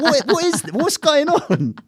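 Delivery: high, bewildered mock outrage; baffled disbelief. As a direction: squeak out total confusion. Bewildered retold reaction.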